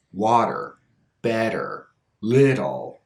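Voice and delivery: "Water, better, little" stressed on the first syllable, T flapped; flap T, unstressed. Shown as a demonstration. Teacher repeating earlier examples, flapped T after stressed vowel. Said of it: "so the T is unstressed".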